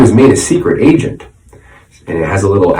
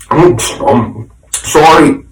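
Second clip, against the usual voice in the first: mumbling; 6 semitones higher, slower than usual. A narrator's reading. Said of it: "I mumbled".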